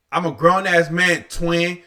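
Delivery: ordinary talk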